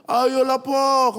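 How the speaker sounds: very high, loud whiny plea; begging child. Retold or imitated. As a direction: beg like a desperate kid. Son begs.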